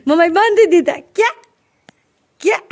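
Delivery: real giggle through the answer; laughing. Real, not acted. Mother laughing as she answers her little daughter.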